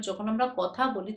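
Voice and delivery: ordinary talk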